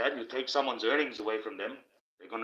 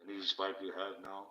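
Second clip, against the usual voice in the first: hushed; 4 semitones lower, 8 dB quieter than usual. Retold or imitated. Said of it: "That's when I say".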